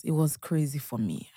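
soft whisper, breath only. Real, not acted. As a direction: hush it as a painful memory. Retelling hard birth.

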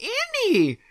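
squeaky high cartoon call of the name; Jar Jar Binks voice. A friend imitating the neighbour's goofy Jar Jar greeting. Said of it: "she says Annie like she's Jar Jar Binks".

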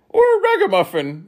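very high, loud minister; minister's voice. A narrator's reading. Minister seconding the offer of bread, high and loud. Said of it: "seconded the minister".

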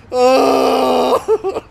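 long, high, loud wail; hammed-up near-tears howl of relief; mock crying. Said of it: "like, I almost cried".

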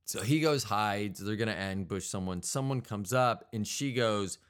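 usual speaking voice

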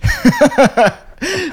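real squeaky laugh; high laugh. Real, not acted. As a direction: let a giggle break out. Laughing at own story.

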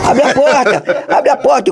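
high, slow pleading for the door to open; urgent plea. Retold or imitated. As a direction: hammer the words like banging a door. Banging on cockpit door. (pedindo com urgência para abrirem a porta)

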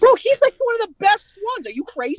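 high-pitched, disbelieving protest; real squeaky disbelief; incredulous outburst. Real, not acted. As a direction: leap to a squeal, outraged on a friend's behalf. Protests at dislike of Spider-Man.

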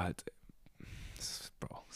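breathless awed whisper. Real, not acted. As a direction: hush it in awe. Awed at playoff thought.

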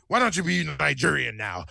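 lightly voiced boss; a hint of an imitated promoter; Vince. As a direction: toss off a casual pitch as the boss. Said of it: "He was like".

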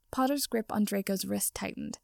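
plain reading voice

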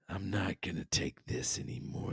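soft whispered vow; hushed, quietly menacing; whispered resolve. Retold or imitated. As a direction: whisper it like a grim promise.

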